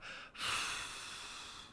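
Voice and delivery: long unvoiced breath out into a bag; paper bag breathing. Anxious person breathing into a paper bag, long exhale. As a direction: blow out slowly as if into a paper bag. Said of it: "and I would go".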